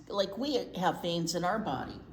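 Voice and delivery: ordinary delivery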